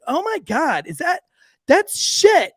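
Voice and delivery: high voice climbing in disbelief; horrified realization. Retold or imitated. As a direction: mimic a girl appalled by her discovery. Girl realizes what she sees.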